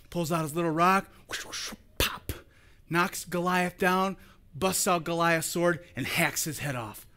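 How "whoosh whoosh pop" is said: soft breathy whoosh whoosh pop; sling whoosh sound effect. Shown as a demonstration. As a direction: blow the sling sounds under your breath. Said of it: "Pulls out his sling".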